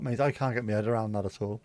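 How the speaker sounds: usual speaking voice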